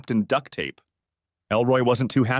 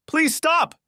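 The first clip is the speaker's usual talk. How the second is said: much higher, pleading shout; high desperate outcry; 3 dB louder than usual. Acted. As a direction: cry out to stop the fight. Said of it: "Lisa shouted".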